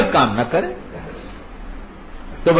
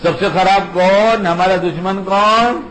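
slow, raised call-and-response question; slow teaching question; 3 semitones higher, slower than usual. Acted. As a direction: draw the children's answer out. Catechism call to children.

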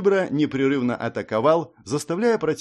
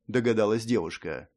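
plain reading voice

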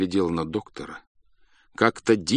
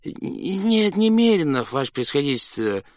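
guilty answer; 7 semitones higher than usual. A narrator's reading. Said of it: "виновато вздохнул".

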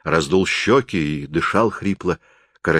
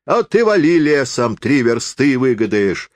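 higher, swooping sing-song peasant voice; jeering peasant forester; 6 semitones higher, 3 dB louder than usual. Acted. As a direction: scoff in broad country speech, high and emphatic. Forester's dialogue, acted. (насмешливый деревенский лесник, высоким голосом)

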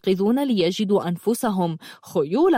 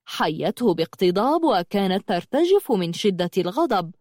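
ordinary delivery, nothing marked

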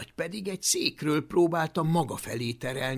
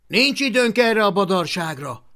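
raised, high-pitched urgent voice; loud; 7 semitones higher, 12 dB louder than usual. Acted. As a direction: cut in loudly to rally them. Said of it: "folytatta hangosan".

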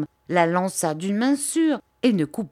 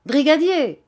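high, louder call down the corridor; 8 semitones higher, 4 dB louder than usual. A narrator's reading. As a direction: shout for the officer. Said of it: "cria-t-il".

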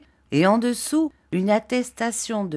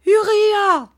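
even higher and louder, the cry held long; louder desperate cry; 9 dB louder than usual. A narrator's reading. Mother calling her son again, higher and louder. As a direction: call him again, more desperate. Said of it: "cria sa mère".

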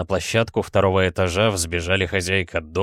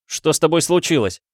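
high, alarmed outcry; raised, high-pitched alarm; crying out; 7 semitones higher, 4 dB louder than usual. A narrator's reading. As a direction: cry the question out urgently. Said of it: "вскричал Адам Каэтан Петрович".

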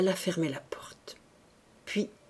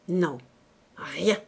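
curt; 4 semitones lower than usual. A narrator's reading. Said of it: "déclara-t-il rudement".

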